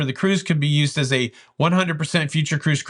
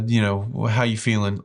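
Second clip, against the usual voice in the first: ordinary talk, nothing marked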